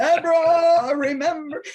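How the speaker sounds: booming loud biblical voice of God; Charlton Heston God voice. Retold or imitated. God calling her name like Charlton Heston, booming loud. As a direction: boom it out like Moses on the mount. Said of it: "like Charlton Heston".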